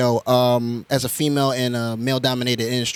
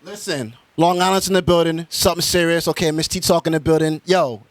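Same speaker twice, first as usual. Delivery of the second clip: hype host outro; 5 semitones higher, 4 dB louder than usual. Acted. Host's hyped show outro.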